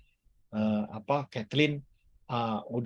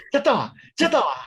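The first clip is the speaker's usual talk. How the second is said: playful laughing urge; 11 semitones higher, 6 dB louder, faster than usual. Acted. Laughing, urging listeners to laugh.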